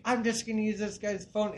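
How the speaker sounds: lightly mock the intruding stranger; mimicking the lady. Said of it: "starts walking up the steps like".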